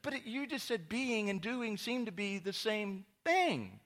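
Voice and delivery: high, loud sarcastic squeak; mock exasperated self. Pastor retelling his reply to a trendy friend, high sarcastic voice. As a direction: play your younger self, baffled and mocking. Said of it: "It's like".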